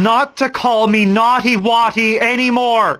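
indignant. Acted. Husband rejecting wife's pet name, indignant. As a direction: snap back loudly at the unwanted nickname.

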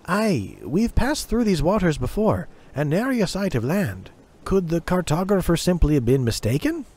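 put-on young navigator wondering aloud; lightly voiced young sailor. A narrator's reading.

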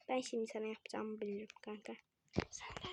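fast soft whisper; fast soft whispered threat. Real, not acted.

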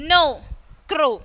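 no and crow with a long "oh"; O as in tomorrow. Shown as a demonstration. Teacher finishing the tomorrow column, long O demo. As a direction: model no, crow separately, ending like tomorrow. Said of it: "pronounced as in tomorrow".

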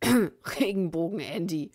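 loud cough-hidden jeer on Regenbogen; coughed mockery. A narrator's reading. Someone mocking Andy behind his hand, coughed. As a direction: fake a cough around the mockery. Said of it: "hustete jemand hinter vorgehaltener Hand".

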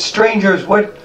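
put-on high urgent old goblin voice; old goblin questioning the strangers, high and urgent; old goblin asking. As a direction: press the strangers in a high, worried voice. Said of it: "he says".

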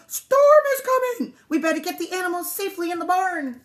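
high, loud alarm call; farmer's urgent holler; bellowed. A narrator's reading. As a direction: shout the storm warning across the farm. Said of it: "he bellowed".

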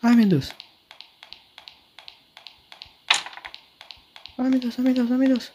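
high, frantic ai meu Deus; real panic, pitch shooting up; panicked. Real, not acted. Panicking while the monster is near.

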